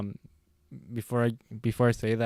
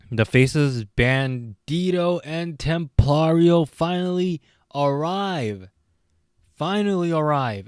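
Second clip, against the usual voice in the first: higher, slow hyped call of the arrival; hype announcer; 5 semitones higher, slower than usual. Acted. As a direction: draw it out like a ring announcer.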